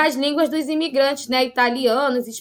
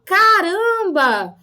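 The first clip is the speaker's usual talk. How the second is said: slow, drawn-out "Caramba!", higher and louder; surprised São Paulo exclamation; 4 semitones higher, 4 dB louder, much slower than usual. Shown as a demonstration. Teacher acting a surprised Paulistano, mock São Paulo accent. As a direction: stretch "Caramba!" like a startled Paulistano. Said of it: "Em São Paulo".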